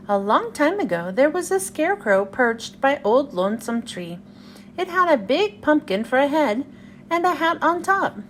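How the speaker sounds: plain reading voice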